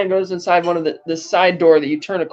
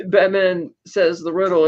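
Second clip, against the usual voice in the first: normal talking voice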